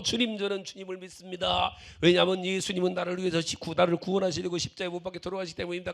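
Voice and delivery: loud recitation, rushed toward the end; mock-earnest loud declaration; loud, joking shout. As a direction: rattle off the confession loudly as a lark. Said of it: "큰 소리로".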